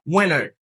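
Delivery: "Winter" said as "WIN-er", no T; American pronunciation. Shown as a demonstration. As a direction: drop the T in "Winter", falling tune. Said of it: "li repete se winter". (di "Winter" san T, alameriken)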